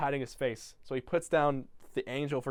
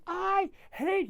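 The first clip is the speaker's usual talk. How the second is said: put-on very high angry kid; mock tantrum; 4 dB louder than usual. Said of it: "he goes".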